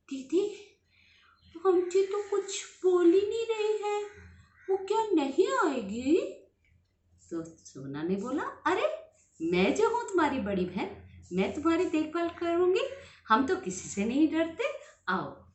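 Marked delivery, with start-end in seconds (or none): 0.1-6.4 scared little brother. Acted. Scared little brother asks sister. 8.4-15.4 higher, brave comforting sister voice; lightly voiced brave big sister; reassuring big sister. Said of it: "प्यार से समझाकर".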